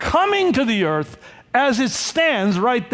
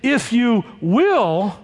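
"will" drawn out and raised; contrastive, lingering WILL; stress on WILL; 3 semitones higher, much slower than usual. Shown as a demonstration. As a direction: lean slowly on the word "will". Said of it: "the father does not say".

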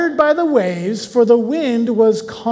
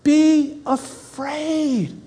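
reassuring Jesus; 5 semitones higher, slower than usual. Acted. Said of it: "Jesus spoke to them saying".

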